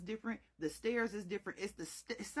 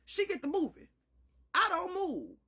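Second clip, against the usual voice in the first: ordinary talk, nothing marked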